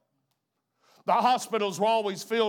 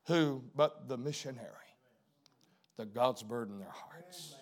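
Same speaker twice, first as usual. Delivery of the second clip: ordinary talk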